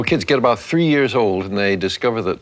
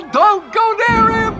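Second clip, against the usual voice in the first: much higher, loud, stretched-out warning yell; people yelling; 3 dB louder, slower than usual. Acted. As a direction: holler a warning across the fields. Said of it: "People yell".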